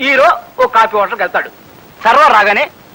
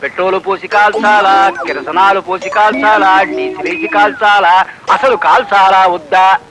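drawn-out menu recital; 6 semitones lower, slower than usual. Retold or imitated. Server listing kinds of dosa.

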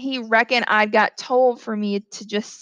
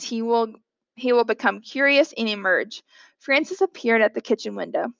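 usual speaking voice

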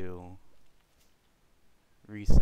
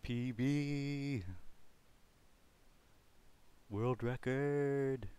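mock-grand announcement; 5 semitones higher, 5 dB quieter, much slower than usual.

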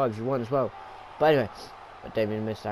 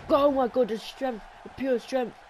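high, breathless awe; amazed excitement; 11 semitones higher than usual. Real, not acted. Commentator marvelling at the underdog's strength, high and amazed.

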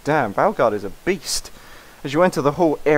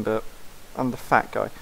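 normal talking voice

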